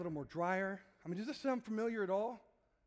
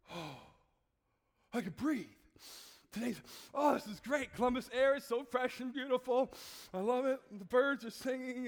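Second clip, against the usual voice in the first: gasp, then high, loud delight; elated relief; 3 semitones higher, 3 dB louder than usual. Acted. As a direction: revel in breathing freely at last. First breath after cold, delighted.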